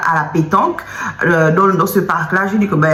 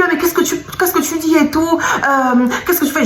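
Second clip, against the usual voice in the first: high, flustered, with a laugh; he's caught and scrambling to deny it; panicked denial; 8 semitones higher than usual. Retold or imitated. Caught boyfriend denying to his girlfriend, high and panicky. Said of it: "Il me dit".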